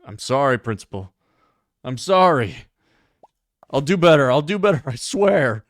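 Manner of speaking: loud, hammed-up pleading; mock pleading. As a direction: swear to do better, overacted. Cook begs principal.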